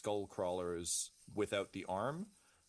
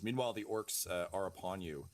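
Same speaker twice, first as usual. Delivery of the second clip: ordinary delivery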